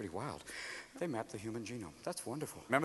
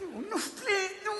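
mock pleading; 12 semitones higher, 9 dB quieter, slower than usual. Driver begging the truck.

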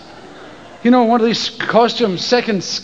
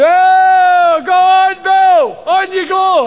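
higher, louder mocking encouragement; jeering friends; 6 semitones higher, 3 dB louder, much slower than usual. School friends jeering at Bill to get in the sea. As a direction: egg him on, loud and teasing.

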